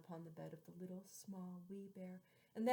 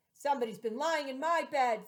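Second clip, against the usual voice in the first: big loud papa bear; great rough gruff voice; 5 semitones higher, 15 dB louder than usual. Acted. Papa bear finding his cushion moved, loud gruff voice. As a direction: boom it out like a huge bear. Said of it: "in a great, gruff, rough voice".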